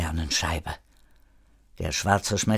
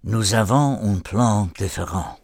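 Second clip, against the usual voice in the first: gebrummelt, französisch. Acted. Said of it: "und brummelte".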